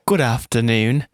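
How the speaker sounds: slow, loud mock-British greeting; British accent. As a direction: put on a drawn-out British accent. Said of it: "did that in a British accent".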